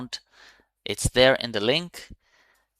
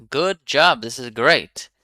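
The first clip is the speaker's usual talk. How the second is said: usual speaking voice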